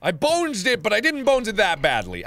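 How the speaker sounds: high-pitched, indignant complaint; indignant protest. Real, not acted. Protesting the mistake.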